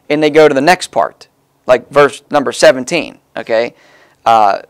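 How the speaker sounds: normal talking voice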